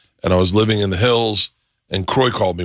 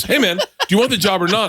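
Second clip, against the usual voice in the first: higher-pitched imitation of an impatient caller; retold irritation; 10 semitones higher, faster than usual. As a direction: imitate someone losing patience on the phone. Retold irritated caller loses patience.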